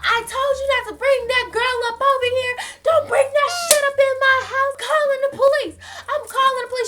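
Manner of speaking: very high, loud imitation of an irate grandmother; hammed-up high-pitched yelling. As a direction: screech it like a grandmother hollering from the back room. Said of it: "she's yelling at this point".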